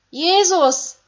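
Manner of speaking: high, loud cry of his name; a voice in the crowd screaming his name. Acted. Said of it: "Ein Schrei aus der Menge".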